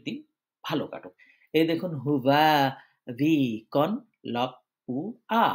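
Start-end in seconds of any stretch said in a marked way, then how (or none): 2.0-5.4 "হুবা ভি কন লপ্পুয়া" slowly, every sound separated. Shown as a demonstration. Teacher showing learners each letter's sound, sound by sound in "হুবা ভি কন লপ্পুয়া". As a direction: spell out "হুবা ভি কন লপ্পুয়া" piece by piece, with pauses. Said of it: "বলে বলে উচ্চারণ করা".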